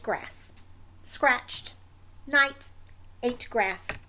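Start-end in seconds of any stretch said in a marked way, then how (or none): none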